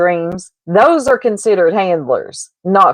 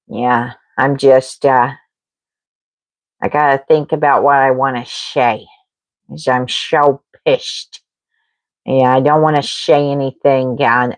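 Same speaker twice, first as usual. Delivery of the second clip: mumbling Purple; 6 semitones lower than usual. Retold or imitated. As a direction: mumble it like you just woke up. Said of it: "She mumbles".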